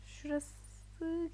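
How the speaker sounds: soft secretive whisper. Real, not acted. Picking the next panel, a whisper. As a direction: murmur it under the breath.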